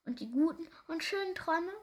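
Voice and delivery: high, breath-caught question; king catching his breath in the rushing wind; gasping for air. A narrator's reading. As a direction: ask it high, as if gasping mid-flight. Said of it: "schnappte nach Luft".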